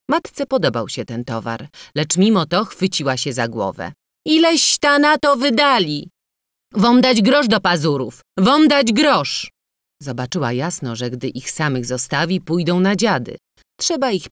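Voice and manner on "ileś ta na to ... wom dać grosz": loud, high-pitched outcry; exasperated scolding. A narrator's reading. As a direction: wail at them over the wasted money. Mother clutches head over spending. (głośne, zrozpaczone besztanie)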